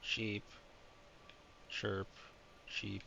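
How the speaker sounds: soft, hushed chirping like a little bird; bird call imitation. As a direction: peep it out quietly, mimicking the bird. Mimicking the bird's call.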